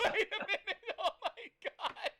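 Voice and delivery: laughing high through the words; words broken by laughter. Real, not acted. Laughing at friend's accent.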